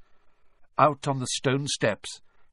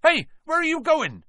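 high-pitched flustered outburst; blurted out; 14 semitones higher, 5 dB louder than usual. A narrator's reading. Hagrid calls after the departing children, flustered blurting. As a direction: call after them in a fluster. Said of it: "he blurted out".

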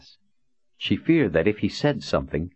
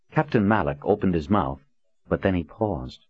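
usual speaking voice

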